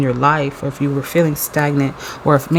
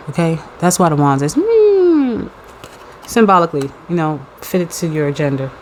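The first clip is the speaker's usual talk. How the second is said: ordinary delivery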